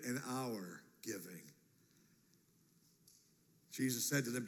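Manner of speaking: ordinary talk, nothing marked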